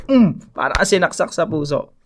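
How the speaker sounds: ordinary delivery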